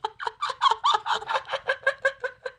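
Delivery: high-pitched real laughing fit; helpless laughter. Real, not acted. Streamer laughing at her blunder with Sal, helpless. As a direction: dissolve into high, embarrassed laughter.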